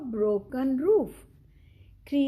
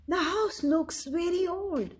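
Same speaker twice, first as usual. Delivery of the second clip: a hint of a young boy, pitched up; lightly voiced boy; 6 semitones higher than usual. Chip looks at the little house, lightly voiced boy.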